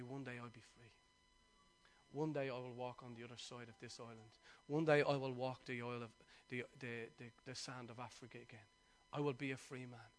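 low, very soft recital of a vow; solemn quoted vow. Retold or imitated. Preacher retells Mandela looking out the cell window, solemn quoted vow. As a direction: quote it quietly and low, with measured resolve.

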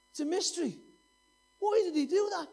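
loud, high-pitched exclamation of bafflement; impassioned wonder. Real, not acted. Preacher marvels to congregation at God's grace, loud and impassioned. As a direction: cry it out, astonished at the mystery.

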